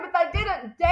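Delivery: ordinary delivery, nothing marked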